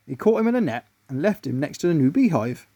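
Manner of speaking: plain reading voice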